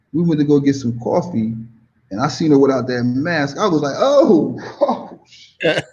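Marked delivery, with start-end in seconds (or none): 4.0-5.8 high "oh" breaking into laughter; pleasantly stunned. Retold or imitated. Man seeing the woman without her mask, amazed. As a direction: act out being floored, laughing.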